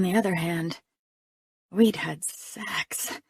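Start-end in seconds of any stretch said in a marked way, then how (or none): 1.7-3.2 slow whisper, rising. A narrator's reading. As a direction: breathe it as a secret. Same words whispered.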